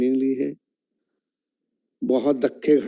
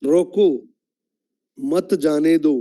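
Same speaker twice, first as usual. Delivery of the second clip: standalone "रोको", long pause, then "मत जाने दो"; pause after रोको; much slower than usual. Shown as a demonstration. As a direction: order it as रोको, break, मत जाने दो. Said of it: "उनको रोक लो". ("रोको" पर रुककर "मत जाने दो")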